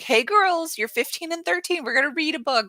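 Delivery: coaxing mom. Acted. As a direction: act out a mother pitching an idea to her kids. Voices herself coaxing her daughters.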